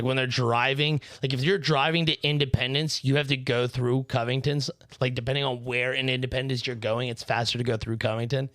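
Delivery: ordinary talk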